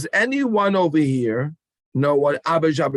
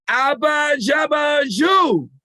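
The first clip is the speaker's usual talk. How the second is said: shouted chant, much higher and louder; with all your might; 11 semitones higher, 3 dB louder, slower than usual. Shown as a demonstration. Leader rallying children with full-force shouted chant. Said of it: "with all of your might".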